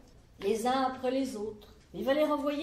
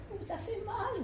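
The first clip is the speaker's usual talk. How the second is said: vieille dame; 9 semitones higher, 8 dB quieter than usual. Acted.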